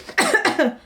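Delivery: high strained throat clear. Real, not acted. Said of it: "Try to come back to myself".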